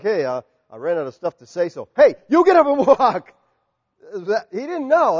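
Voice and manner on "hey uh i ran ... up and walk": mock flippant Paul. Preacher mocking a careless Paul, laughing shout. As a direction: toss it off like a careless joke. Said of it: "is it just like".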